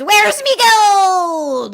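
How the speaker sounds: high, loud, very slow growl; leprechaun voice. Acted. Leprechaun demands his gold over the phone. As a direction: menace them for the gold. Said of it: "Leppy says".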